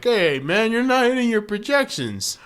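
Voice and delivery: high, loud corporate boss voice; mock manager. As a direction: scold like a pushy manager. Mocking a manager.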